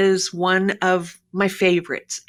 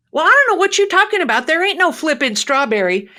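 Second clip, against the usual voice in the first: higher, fast, indignant country customer; indignant yokel; 8 semitones higher, 4 dB louder, faster than usual. Retold or imitated. Customer protests to the pharmacist, fast indignant yokel. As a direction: snap back, baffled and offended.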